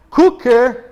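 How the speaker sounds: "Cooker" said loud and high, in scare quotes; mock the wrong word out loud; teacher mocking the wrong word; air-quoted cooker. Shown as a demonstration. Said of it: "olha minhas aspas".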